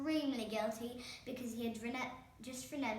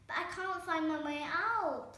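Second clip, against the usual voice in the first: higher, louder desperate plea; pleading; 7 semitones higher, 7 dB louder than usual. A narrator's reading. Herb pleads with the fairy godmother to get out. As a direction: beg at your wit's end. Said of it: "pleaded Herb".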